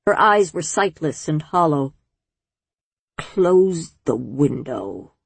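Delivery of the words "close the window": put-on slow, low rasp of a dying old woman. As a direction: drag it out in a dry rasp. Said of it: "she rasped".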